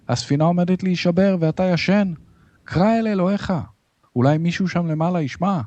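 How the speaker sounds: high-pitched agitated command; urgent plea. A narrator's reading. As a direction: urge a sleeper to act, pitched high. Captain urges Jonah during the storm. (הפצרה נסערת בקול גבוה)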